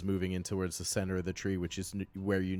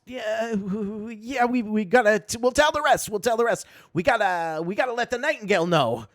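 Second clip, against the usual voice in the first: role; 13 semitones higher, 7 dB louder than usual. Acted. Glitterbomb urges the team.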